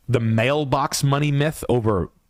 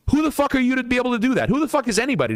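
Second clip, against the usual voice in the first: much higher, fast indignant outburst; indignant rant; 11 semitones higher, faster than usual. Real, not acted. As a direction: fire it off fast and high, outraged. Ranting at would-be retirees.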